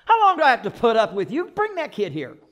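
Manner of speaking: impatient outburst. Retold or imitated. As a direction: hurry them up, out of patience. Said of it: "I don't know how it sounded".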